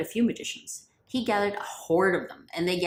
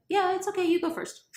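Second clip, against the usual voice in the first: much higher, breezy and unbothered; airy nonchalance; 12 semitones higher than usual. Acted. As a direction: toss it off lightly, no worries. Musa casually lets them start.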